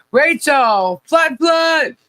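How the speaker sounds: high called-out greeting; calling out. Real, not acted. Streamer greeting arrivals in the chat, calling out. Calling out viewers in roll call.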